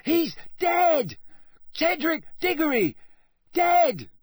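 high, loud shrieked cries; screeching crowd. Acted. Panicked crowd screeching the death into the night, very high and loud. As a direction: shriek the news to everyone. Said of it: "screeched it into the night".

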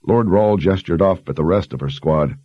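usual speaking voice

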